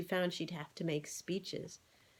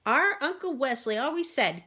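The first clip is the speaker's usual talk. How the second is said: quacking; 7 semitones higher, 5 dB louder than usual. A narrator's reading. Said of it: "quacked Alice".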